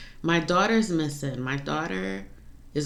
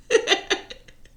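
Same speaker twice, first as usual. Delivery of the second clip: a short, breathy laugh, a little louder, holding back tears; rueful laugh; 5 dB louder than usual. Real, not acted. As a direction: laugh so you don't cry. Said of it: "I'm laughing because I'm trying not to cry".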